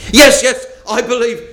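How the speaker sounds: high, loud, eager yes. Acted. Dangling tourist eagerly answers the voice, high and loud. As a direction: grab at the lifeline.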